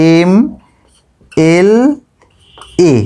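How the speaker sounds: এম, এল, এ said as separate letters with long pauses; spelling out letters. Shown as a demonstration. Teacher spells এম এল এ slowly for students. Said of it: "বলি কিভাবে?".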